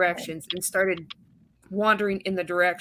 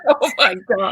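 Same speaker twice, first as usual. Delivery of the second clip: louder, laughing exclamation; 7 dB louder than usual. Real, not acted. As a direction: crack up at the idea. Friends laughing at sheep theory.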